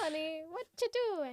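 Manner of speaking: put-on high teasing Italian accent. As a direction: tease him like an Italian woman. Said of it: "I try to just do an Italian accent".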